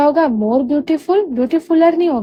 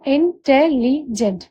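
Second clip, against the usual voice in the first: Intelligent slowly in four parts, इं-टे-लि-जेंट; measured four-beat intelligent for learners; syllable by syllable; much slower than usual. Shown as a demonstration. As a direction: count out इं, टे, लि, gent with short breaks. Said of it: "चार syllable हुए".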